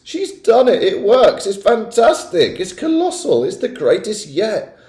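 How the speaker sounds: high, loud cheering voices; excited cheering. A narrator's reading. Witch audience praising the Grand High Witch, loud excited cheers. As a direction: cheer in wild delight.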